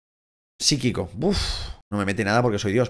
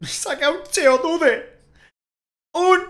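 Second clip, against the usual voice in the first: slow, high pleading chant; sing-song wish at the game; high drawn-out plea; much slower than usual. Real, not acted. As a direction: stretch it out high like a prayer. Begging the game for a Pokémon.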